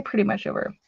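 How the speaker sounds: normal talking voice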